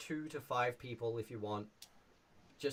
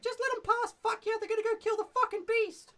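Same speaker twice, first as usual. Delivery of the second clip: much higher and louder, a cheeky goblin sentry; brash goblin challenging strangers at the bridge; 6 dB louder than usual. Shown as a demonstration. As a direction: squeak it out like a rude little goblin guard. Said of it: "he's like".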